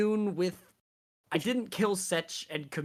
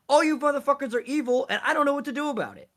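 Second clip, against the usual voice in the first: higher, louder and fast, fed up; exasperated rant; 8 semitones higher, 5 dB louder, much faster than usual. Acted. Character scolds the bickering party, fast and loud. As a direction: throw up your hands at them all.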